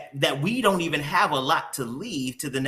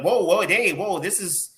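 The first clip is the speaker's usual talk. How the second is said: higher, exclaimed mock alarm; startled; 6 semitones higher, 3 dB louder than usual. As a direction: act out someone taken aback. Acts out a startled person's reaction.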